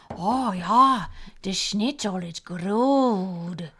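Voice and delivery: slow mock German accent; bad mock German accent. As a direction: drag out a hammed-up German accent. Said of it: "Your German accent is offensive".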